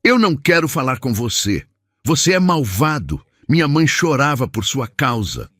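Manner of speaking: put-on high child's voice, hurt and furious; a boy pushing away the father who left; angry boy. Boy angrily rejects his father.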